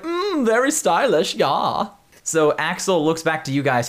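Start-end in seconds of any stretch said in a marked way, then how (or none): 0.0-1.9 high, slow, pleased Klaus voice. Acted. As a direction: preen over the bandana. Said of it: "He's like".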